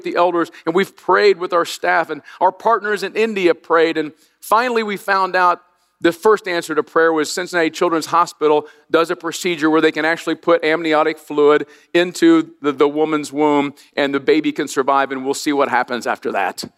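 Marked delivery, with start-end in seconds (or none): none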